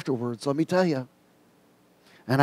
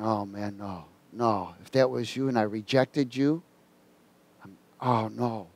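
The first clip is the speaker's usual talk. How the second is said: low, hushed self-reproach; quiet, low inner lament; dismayed realization; 4 semitones lower, 7 dB quieter than usual. Retold or imitated. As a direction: sink into soft, regretful disbelief. Acting out his dismayed inner thought.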